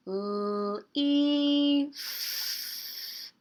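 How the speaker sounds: "leaf" held sound by sound; stretched-out word. Shown as a demonstration. Teacher asking children for last letter, stretched out. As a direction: hold L, then ee, then a long airy F. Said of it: "stretch that word out".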